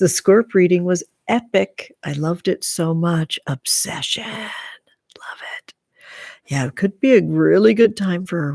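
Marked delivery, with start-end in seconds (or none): 4.2-5.7 "Love it" whispered softly. Real, not acted. Streamer reacting to a viewer's comment, soft whisper. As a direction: breathe "Love it" without voice.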